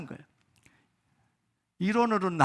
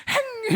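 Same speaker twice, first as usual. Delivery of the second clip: sharp, very high huffy "행!"; dog's huff; 4 dB louder, much slower than usual. Retold or imitated. Dog storming off after a scolding, huffy high snort. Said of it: "이러고".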